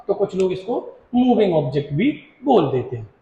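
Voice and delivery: ordinary delivery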